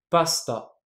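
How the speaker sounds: pasta with the u dropped, only the s heard; devoiced vowel. Shown as a demonstration. Teacher shows the silent vowel in pasta, s kept bare. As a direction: say pasta letting the u vanish after the s. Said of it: "j'ai pas prononcé le u".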